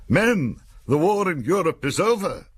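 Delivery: high, loud, eager shout to the ranks; enthusiastic shout. Retold or imitated. New officer shouting the news to his men, high and eager. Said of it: "shouted with enthusiasm".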